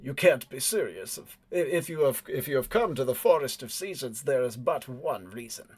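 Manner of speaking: lightly voiced befuddled heavy-set fellow. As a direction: stammer a little in mild disbelief. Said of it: "befuddled more than anything else".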